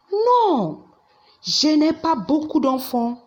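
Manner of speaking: a high, firm no, then a steady denial; emphatic no. Shown as a demonstration. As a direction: deny it with a sharp, high-pitched no. Answering the question.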